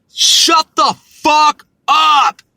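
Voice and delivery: slow, loud shouted command, voice up; angry shout. Real, not acted. Yelling at Britain to stop the Brexit talk, furious shout. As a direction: bark it out, fed up with the nonsense.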